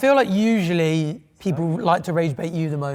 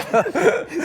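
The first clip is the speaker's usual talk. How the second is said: laughing; 10 semitones higher than usual. Real, not acted. Laughing at joke.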